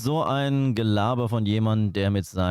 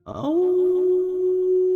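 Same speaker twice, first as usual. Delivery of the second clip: high yelp; 3 dB louder than usual. Pretend. Mock pain yelp.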